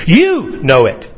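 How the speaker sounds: slow, emphatic YOU, falling away after; stress on YOU. Shown as a demonstration. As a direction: hit "You" hard, let "know it" drop. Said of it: "The you is in the emphatic position".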